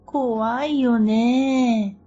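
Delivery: slow, drawn-out spooked whine; scared. Retold or imitated. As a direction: shiver as you say it. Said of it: "と、二人ですくみ上がった".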